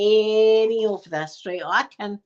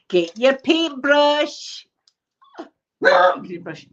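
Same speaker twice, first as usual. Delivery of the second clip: slow, louder drawn-out order; slow teacher command; 6 semitones higher, 5 dB louder, much slower than usual. Retold or imitated. Teacher instructing the class, slow drawn-out order. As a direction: stretch each word like a bossy teacher.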